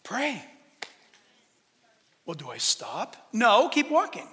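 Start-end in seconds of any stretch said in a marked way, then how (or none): 2.3-3.2 low, soft mock question; doubtful questioner. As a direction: drop down low and quiet as a dim questioner.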